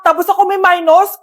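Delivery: usual speaking voice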